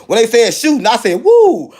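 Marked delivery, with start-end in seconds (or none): 1.2-1.7 high, loud "woo"; startled whoop. Retold or imitated. Man hearing investigators mention a shooting, startled whoop. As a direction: let out a sudden high whoop of alarm.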